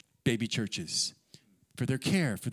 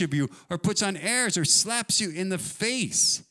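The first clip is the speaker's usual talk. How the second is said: raised, driving recitation; emphatic scripture reading; 5 semitones higher than usual. Acted. Preacher reading Paul's boast to the church, emphatic recitation. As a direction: read it out with mounting emphasis. Reads scripture aloud forcefully.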